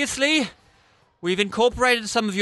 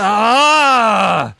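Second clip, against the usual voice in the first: loud exasperated shout; a sudden frustrated cry; 8 dB louder than usual. Acted. Director's outburst.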